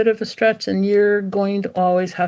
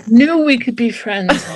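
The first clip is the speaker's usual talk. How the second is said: delighted puppy; 5 semitones higher, 4 dB louder than usual. Acted.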